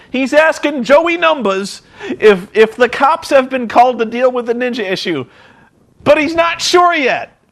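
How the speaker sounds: incredulous, high-pitched ridicule; mock incredulity. Real, not acted. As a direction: stretch it out high in mock outrage. Mocking the feeble investigation.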